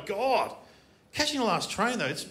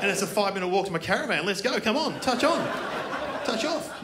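hustle your date along eagerly; eager hurrying; 3 semitones higher than usual. Acted. Comic urging his date along the train.

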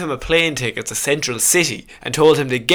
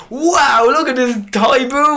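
high, excited mock advert voice; mock toy advert pitch; 7 semitones higher than usual. Mascot pitching the toy boomerang to viewers, hammed-up advert cheer. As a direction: hype the toy like a cheesy TV commercial. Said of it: "Just be like".

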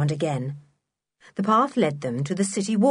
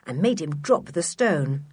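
ordinary delivery, nothing marked

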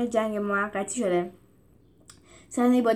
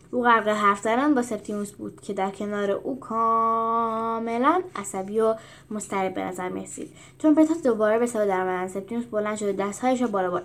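ordinary delivery

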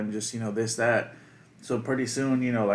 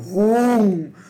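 loud, high spoken "whoom" whoosh; whooshing noise; 8 semitones higher, 7 dB louder than usual. Retold or imitated. Storyteller showing how fast he ran, whooshing noise. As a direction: imitate someone zooming past, pitched up. Imitates man racing off to class.

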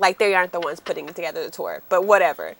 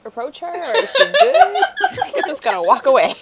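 retold quote broken up by high laughter; laughing through quotes; 7 semitones higher, 5 dB louder, faster than usual. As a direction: crack up while voicing the onlooker. Joking about a baffled security lady.

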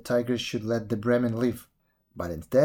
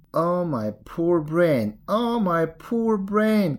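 slow, high lament, rising higher; wailing jackal; 6 semitones higher, 3 dB louder, much slower than usual. A narrator's reading. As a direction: wail in drawn-out confusion. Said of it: "cried the jackal".

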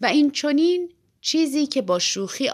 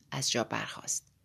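plain reading voice

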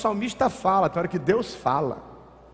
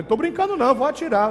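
much higher threatening voice; gunman threat; 8 semitones higher than usual. Retold or imitated. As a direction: warn you'll shoot. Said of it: "o cara foi e puxou". (assaltante ameaçando, voz bem aguda)

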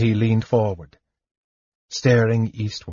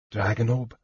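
muttered; 7 dB quieter than usual. A narrator's reading. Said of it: "Sturm muttered".

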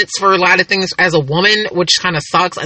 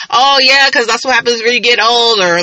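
lightly imitated sister's needling, higher pitched; mock teasing; 5 semitones higher than usual. Sister needling her sibling about getting older, mock teasing. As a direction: tease like a know-it-all sibling, raised pitch.

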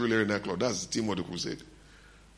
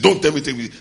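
higher, much louder snapped refusal; retold angry retort; 7 semitones higher, 10 dB louder, faster than usual. Angry preacher answering his wife, retold angry retort. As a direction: fire back in mock exasperation.

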